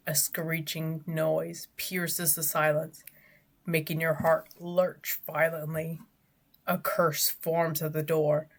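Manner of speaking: ordinary talk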